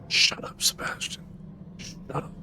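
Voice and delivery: muttered. Real, not acted. Annoyed gamer hushing character.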